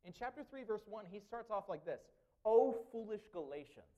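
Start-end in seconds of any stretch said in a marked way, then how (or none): 2.4-3.9 loud scolding cry, pitch up; strong rebuke. Retold or imitated. Preacher voicing Paul rebuking the Galatians, loud scolding. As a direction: call them fools with full force. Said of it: "Now that's a correction that's strong".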